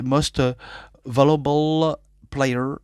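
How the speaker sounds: slow, careful English in a French accent; hesitant French-accented English. Real, not acted. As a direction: spell out Valuable Player slowly with a French accent. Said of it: "mon accent anglais un peu boiteux".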